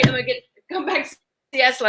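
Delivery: normal talking voice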